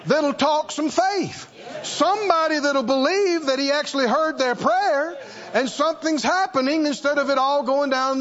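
high, ringing sermon delivery; rally them to talk faith; preaching at full force. Acted. Preacher calling the church to be salt of the earth, full force.

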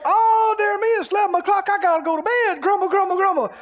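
high, fast fussy exclamation; hammed-up flustered complainer; fussy old fusspot. Imitating fussy viewer at bedtime.

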